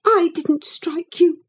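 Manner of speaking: put-on high, defensive girl's voice; upset young lady. A narrator's reading. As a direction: protest it while holding back tears. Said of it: "muttered Cathy".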